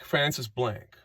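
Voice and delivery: quiet aside repeating Francis Blank. Shown as a demonstration. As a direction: let the name fall away quietly.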